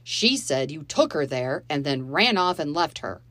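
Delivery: loud, accusing man; angry stepfather. Acted. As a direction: lay into the children. Dave berating the children.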